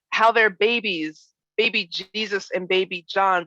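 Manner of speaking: usual speaking voice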